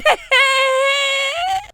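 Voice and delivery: high squealing laugh; joyful laugh. Acted. Baker squeals with joy at her news. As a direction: squeal with delight.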